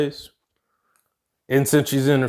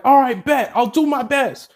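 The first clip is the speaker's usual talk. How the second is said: high, game reply called back; acted gung-ho yes, pitched up; eager agreement; 9 semitones higher than usual. Teammate accepting the heroine's orders, eager agreement.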